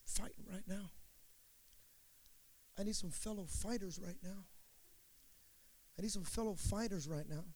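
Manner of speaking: soft, restrained, with long pauses; quiet fighter voice. Acted. Preacher voicing people who walk big, quiet fight voice. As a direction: understate it, no yelling at all. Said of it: "they're not yelling, they're not screaming".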